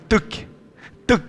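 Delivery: breathy clicked tuc; whispered tuc; whispered swing sound. Shown as a demonstration. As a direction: mimic the swing's tap at the top. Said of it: "aquela hora que faz assim".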